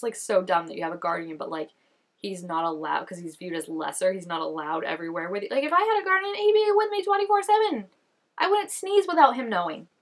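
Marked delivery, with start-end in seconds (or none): 5.5-9.9 excited. Real, not acted. Enthusing about having a guardian.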